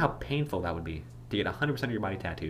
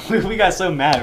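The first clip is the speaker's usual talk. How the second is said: ordinary talk, nothing marked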